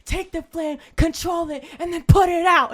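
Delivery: high, loud pumped-up corner yell; rally the fighter like a fireman coach; fired-up coach speech. Retold or imitated. Coach hyping his fighter in the corner, mock shouting.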